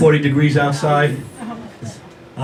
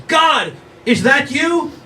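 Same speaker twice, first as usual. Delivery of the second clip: high, slow, wondering call upward; awed question; 10 semitones higher, much slower than usual. Retold or imitated. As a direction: ask the sky in awe. Fisherman asks the heavens.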